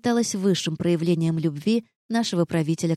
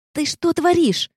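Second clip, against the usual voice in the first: sharp, much higher, louder hiss; angry hiss; 11 semitones higher, 4 dB louder, slower than usual. A narrator's reading. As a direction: hiss it out in alarm. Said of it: "прошипела она".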